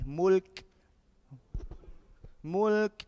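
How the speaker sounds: Mulk with a puff of air after the K; Mulk, held then let out in an airy K; releasing some air. Shown as a demonstration. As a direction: release the final K of Mulk with breath. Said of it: "just releasing some air".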